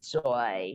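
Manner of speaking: "Shui" slow, sinking low; nada ketiga. Shown as a demonstration. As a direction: draw out "Shui" on a low third tone. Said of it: "nada ketiga". ("Shui" pelan dengan nada rendah turun)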